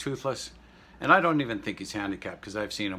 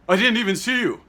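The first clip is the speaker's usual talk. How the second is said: dismissive retort; 12 semitones higher, 11 dB louder than usual. Retold or imitated. Rude woman brushing him off.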